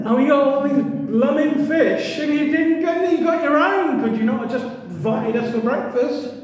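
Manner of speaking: hammed-up indignant disciple; exasperated complaint. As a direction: protest like a put-upon fisherman. Disciples complaining to Jesus about fish.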